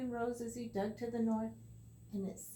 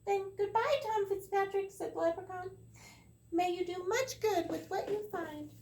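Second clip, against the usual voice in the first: high, louder farewell; leprechaun voice; 10 semitones higher, 4 dB louder than usual. Acted. The leprechaun says goodbye to Tom in a high voice. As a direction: wish him well like a cunning wee man.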